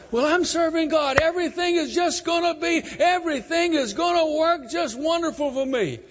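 high, loud sing-song mockery; mock naive believer. Preacher mocking radio preachers' listeners, high and loud. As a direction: parody the gullible optimist.

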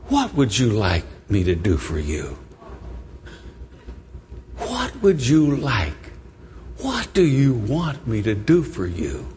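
low, hushed, tender question; with love, unhurried and low; compassionate. Acted. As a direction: ask gently, leaving long pauses. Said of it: "looks at him with compassion".

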